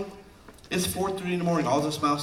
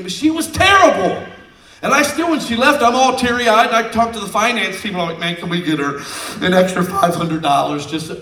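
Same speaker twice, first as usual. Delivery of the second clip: loud emotional retelling; 6 semitones higher, 8 dB louder than usual. Real, not acted.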